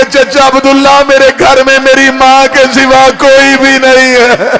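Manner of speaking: loud, slow, keening cry; loud wailing son's lament. Acted. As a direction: wail his name through tears. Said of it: "रो के कहने लगे".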